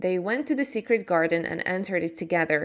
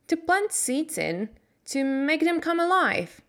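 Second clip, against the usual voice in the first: put-on much higher, earnest girl's voice; a girl pleading for a garden; pleading Mary; 8 semitones higher than usual. Mary pleading with Mister Craven, higher.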